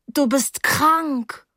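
high, slowly drawn-out quotation; ghastly grin. A narrator's reading. Girl quoting Blake at the bandaged boy, ghastly grin. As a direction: stretch it out high through an awkward grin. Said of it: "sagte ich wieder mit grausigem Grinsen".

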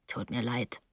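very low and soft; subdued apology. Acted. Terrapin gives in to Mrs Deering, low and subdued. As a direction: apologise under your breath, pitched right down.

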